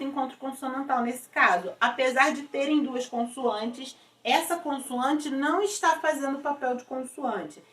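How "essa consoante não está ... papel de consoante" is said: normal talking voice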